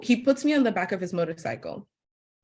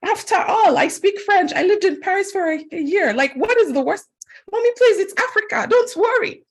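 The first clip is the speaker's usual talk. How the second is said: much higher, pleading, whiny younger self; mock bratty daughter; 4 dB louder than usual. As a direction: beg mom to stop fussing, high and whiny. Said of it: "So I'm like".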